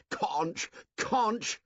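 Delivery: high, mocking shout of the word; scornful shout. Jack mocks the conch before the assembly, shouting. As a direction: jeer at the conch. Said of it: "shouted Jack".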